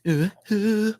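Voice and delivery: high rising ooh hoots; playful hoots. Pretend. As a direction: hoot along playfully. Playful hooting.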